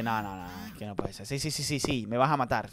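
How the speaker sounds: usual speaking voice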